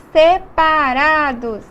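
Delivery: "separados" slowly broken syllable by syllable; syllables pulled apart. Shown as a demonstration. Teacher showing that the vowels split, "separados" said in separate syllables. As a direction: stretch "separados" into distinct, separated beats.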